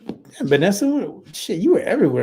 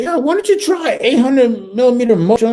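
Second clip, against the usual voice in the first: high, louder breezy doctor voice; mock doctor; 9 semitones higher, 4 dB louder than usual. Mocking a civilian doctor who suggests Motrin for migraines, high and breezy. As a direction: toss off the advice like a clueless doctor. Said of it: "And he said".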